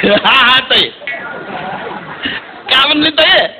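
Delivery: put-on Solapuri boy on the phone. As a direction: play the village lad chatting to his sister. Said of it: "कसा बोलत होता बघा तुम्ही".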